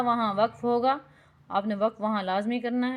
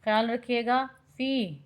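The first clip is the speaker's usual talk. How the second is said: ordinary talk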